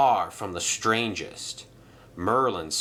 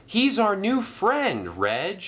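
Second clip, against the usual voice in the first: higher, slow pleading; earnest engine; 11 semitones higher, slower than usual. Acted. Arthur pleading with Reg, higher and slow. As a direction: plead for a friend.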